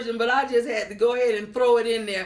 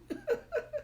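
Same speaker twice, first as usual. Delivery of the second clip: high laugh; 12 dB quieter than usual. Real, not acted. Laughs after joke.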